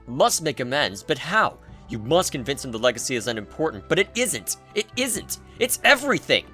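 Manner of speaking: ordinary delivery, nothing marked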